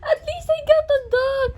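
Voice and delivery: high, loud laughing shriek; squealing excitement. Real, not acted. Vlogger finally getting a dog figure, squealing laugh. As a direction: laugh and shriek with delighted relief.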